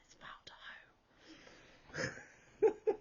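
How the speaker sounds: secret triumphant whisper; a hushed inner yes. Retold or imitated. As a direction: whisper it like a private victory. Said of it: "But inside I was like".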